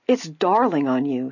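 usual speaking voice

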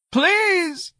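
put-on high, pleading old man near tears; tearful plea. A narrator's reading. Old man begs the sergeant, tearful. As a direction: cry out a desperate please. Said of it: "Mister Robichaud was in tears".

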